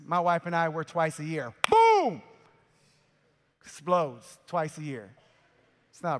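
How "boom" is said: sudden high, loud boom; shouted boom. Pretend. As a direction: blast it out like an explosion. Acting out an explosion.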